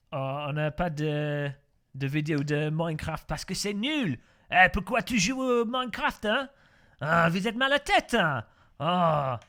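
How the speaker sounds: loud, hammed-up French indignation; mock French scolding. Streamer mock-scolds a viewer asking for Minecraft, exasperated French accent.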